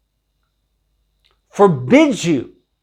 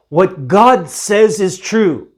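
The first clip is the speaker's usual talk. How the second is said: normal talking voice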